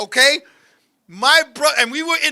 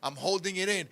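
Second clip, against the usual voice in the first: soft, lower, held-in voice; hushed self-restraint; 5 semitones lower, 13 dB quieter than usual. Retold or imitated. Teller reliving trying not to laugh at brother, hushed. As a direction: keep it small, as if stifling laughter. Retelling a struggle to stifle laughter.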